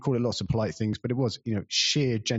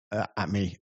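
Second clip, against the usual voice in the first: ordinary talk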